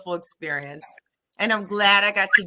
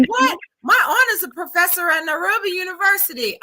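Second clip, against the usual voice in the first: very high startled exclamation; high-pitched, excited disbelief; retold amazement; 10 semitones higher, 4 dB louder than usual. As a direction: imitate an astonished stranger blurting news. Retelling the stranger's amazed reply.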